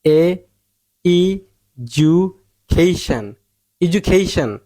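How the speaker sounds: এজুকেইশান broken into "ee, joo, KEI-shan", then joined; correct pronunciation. Shown as a demonstration. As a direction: build এজুকেইশান syllable by syllable. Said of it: "সঠিক উচ্চারণ হচ্ছে". (এজুকেইশান ভেঙে ভেঙে, "কেই"-তে জোর)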